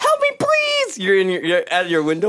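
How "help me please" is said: very high mock plea; hammed-up desperate plea; pleading. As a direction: play a neighbor begging at the window. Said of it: "You're like".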